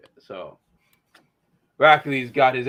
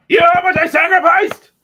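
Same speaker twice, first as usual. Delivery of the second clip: desperate villain; 10 dB louder than usual. Retold or imitated. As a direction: plead then explode. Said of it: "the running gag ... is like".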